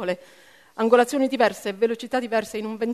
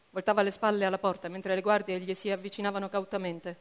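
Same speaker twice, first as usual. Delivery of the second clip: ordinary delivery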